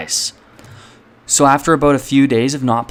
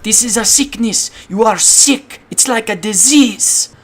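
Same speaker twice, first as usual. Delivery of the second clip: mom's voice; 11 semitones higher than usual. Retold or imitated. Mom calling it a disease.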